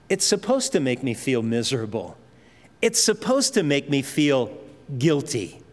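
usual speaking voice

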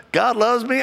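high, bright-eyed mock of wife's easy certainty; imitating his wife. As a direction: toss it off high and carefree, as his wife would.

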